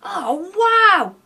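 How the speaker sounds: high, loud burst of wonder; awed exclamation. A narrator's reading. Sam slinks in and sees goodies, awed. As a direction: marvel out loud at the sight. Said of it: "whispered Sam".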